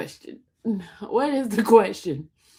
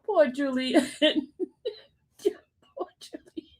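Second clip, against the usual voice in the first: high, laughing until the voice gives out; helpless laughter; 6 semitones higher, slower than usual. Real, not acted. Host cracking up at viewers, helpless laughter. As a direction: talk through real, tearful laughter. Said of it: "y'all making me cry in here".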